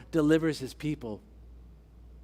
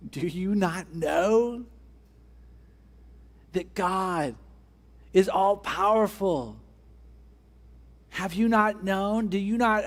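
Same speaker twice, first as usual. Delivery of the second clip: fervent preaching; 4 semitones higher, 3 dB louder than usual. Acted. Repeated rhetorical questions, raised voice.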